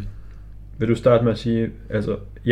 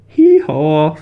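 high, loud hee-haw; donkey bray; 7 semitones higher, 9 dB louder than usual. Pretend. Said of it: "Så siger vi lige".

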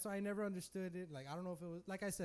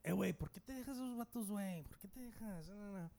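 fast, a bit higher street Spanish; Spanish-speaking dude; 3 semitones higher, 3 dB quieter, faster than usual. Retold or imitated. Lightly voiced dude urging him to fight back. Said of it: "he tells me in Spanish".

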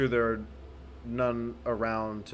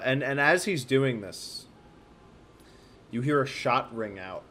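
ordinary talk

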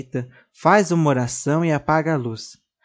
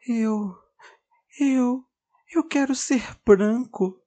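tearful doll; 9 semitones higher, slower than usual. Acted. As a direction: stammer it out through crying. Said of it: "volta a chorar".

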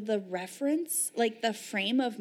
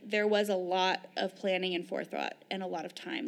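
ordinary delivery

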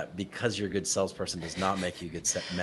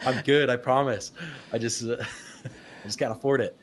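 higher, louder mock-pleading pitch; sympathy-card pleading; 5 semitones higher, 3 dB louder than usual. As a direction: play up the sympathy with a laugh. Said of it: "playing that sympathy card".

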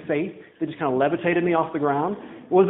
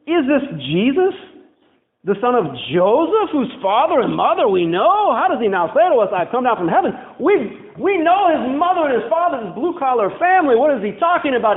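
higher, louder mock grumble of the crowd; grumbling crowd; 5 semitones higher, 4 dB louder than usual. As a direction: scoff like doubting neighbours. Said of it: "they grumbled".

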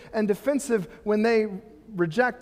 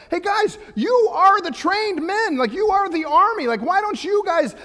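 high, loud rallying pep talk; David rallying the army to fight, loud and high; pumping them up; 9 semitones higher, 4 dB louder than usual. Retold or imitated. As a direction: fire the soldiers up like a coach. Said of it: "trying to pump the guys up".

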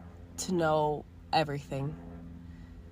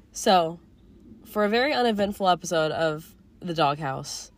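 ordinary talk

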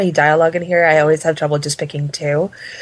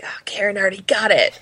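whisper. Retold or imitated. Retelling her own reaction.

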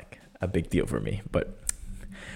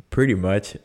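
ordinary talk, nothing marked

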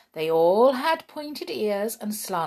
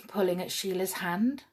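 ordinary delivery, nothing marked

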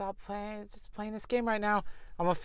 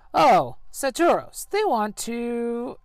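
higher, slow, drawn-out; hammed-up villainess; villain girl; 7 semitones higher, 5 dB louder, much slower than usual. Villain girl pleading to Saturos, high and slow. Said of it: "villain girl speaks to Saturos".

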